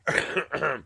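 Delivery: rough hacking cough; harsh smoker's cough. Real, not acted. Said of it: "Smoker's cough sucks".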